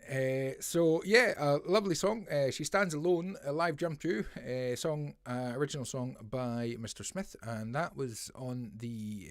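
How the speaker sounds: ordinary talk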